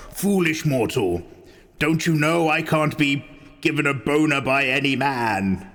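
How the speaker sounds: lightly voiced dark lord. Mock Witch King speech.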